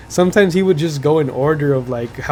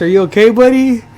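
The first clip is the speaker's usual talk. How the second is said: higher and louder teasing copy of the catchphrase; mocking the teacher; 7 semitones higher, 6 dB louder than usual. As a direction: parrot the teacher to tease a friend. Speaker mocks teacher's catchphrase.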